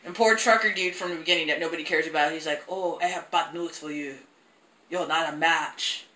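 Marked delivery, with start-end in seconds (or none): 2.7-6.1 put-on low, soft doctor with German accent; slow to a hush in a fake German accent; mock German accent. Imitating the German doctor again.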